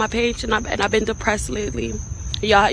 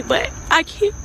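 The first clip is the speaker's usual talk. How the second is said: choked-up, higher-pitched apology; real crying; 5 semitones higher than usual. Real, not acted. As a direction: break off as tears take over.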